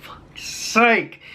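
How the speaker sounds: slow, drawn-out groan of frustration; exasperated. Retold or imitated. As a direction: let it sag with fed-up disbelief. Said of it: "we're like".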